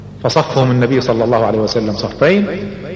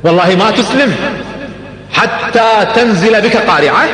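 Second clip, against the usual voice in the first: raised, slow and weighty warning; a slow, high, solemn threat; a grave oath; stern warning; 6 semitones higher, slower than usual. Retold or imitated. Prophet warns Umar sternly.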